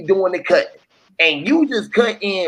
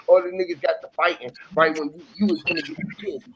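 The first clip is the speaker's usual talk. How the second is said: ordinary delivery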